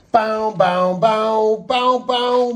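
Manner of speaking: pow the punches out like a cartoon; punch noises. Narrator voicing the punches in a fight clip with high mock sound effects.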